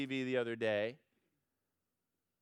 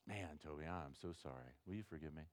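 sheepish apology; 9 semitones lower, 15 dB quieter than usual. Pretend. Scott apologizing again.

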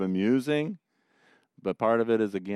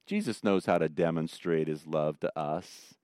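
ordinary delivery

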